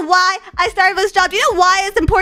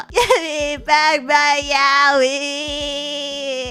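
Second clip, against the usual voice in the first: very slow whimpering plea; a hammed-up weep for stolen comics; mock sob; 7 semitones lower, 3 dB quieter, much slower than usual. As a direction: drag it out through fake tears. Fake crying plea.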